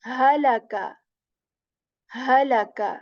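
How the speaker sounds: halaka said with a clear throat H then a. Shown as a demonstration. Teacher reading the example word aloud. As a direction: start halaka crisply from near the chest. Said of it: "This is the word here".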